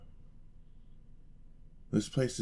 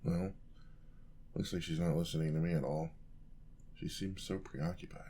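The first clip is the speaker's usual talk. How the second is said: softer, half to himself; muttered aside; 3 semitones lower, 11 dB quieter than usual. Acted. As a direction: drop the voice and comment to yourself. Aside about the distracted blacksmith.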